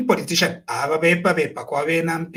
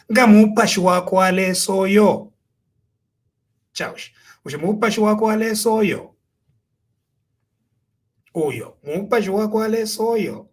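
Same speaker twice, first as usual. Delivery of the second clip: "mupashi wako aleso yo" held on a raised, level tune, falling at the end; pointed questioning intonation; 3 semitones higher than usual. Shown as a demonstration. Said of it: "Mwayo mfa intonation".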